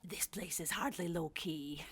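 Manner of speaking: soft whispered teasing remark; a quiet conspiratorial whisper; hushed wry aside. Acted.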